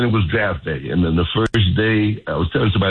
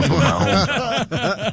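laughing; 12 semitones higher, slower than usual. Real, not acted. Laughing at his own story.

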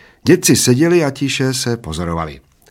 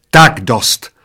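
shrieked, understated; 2 semitones higher, 7 dB louder than usual. A narrator's reading. Waitress stops the old men, understated shriek. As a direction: put a loud stop to it. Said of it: "zaječela číšnice".

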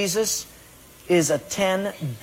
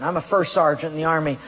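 ordinary talk, nothing marked

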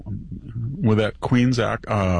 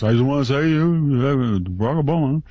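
mock Obama voice; 3 semitones higher than usual.

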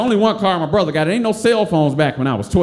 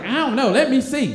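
pushy Tracy; 7 semitones higher than usual. Retold or imitated. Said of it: "Tracy was always real aggressive".

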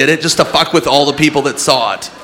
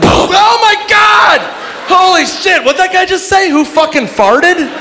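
very high, shouted comic panic; panicked onlooker; 3 dB louder than usual. Acted. Witness shocked by man's last words, panicked. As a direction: react in hammed-up horror.

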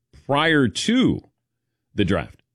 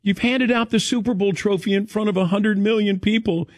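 higher mock voice of the wife; imitating wife; 9 semitones higher than usual. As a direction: play the wife, teasing and high. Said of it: "my wife goes".

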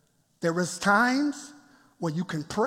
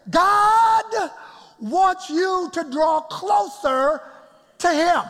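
rising preacher shout; 6 semitones higher, 4 dB louder, much slower than usual. Acted. Preaching climax.

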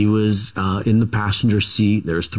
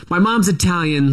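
usual speaking voice

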